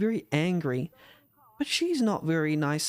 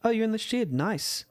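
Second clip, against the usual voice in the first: higher, breezy imitation of not caring; mock nonchalant higher-voiced shrug; mock casual; 8 semitones higher than usual. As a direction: toss it off lightly, unbothered. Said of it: "act like he's supposed to be like".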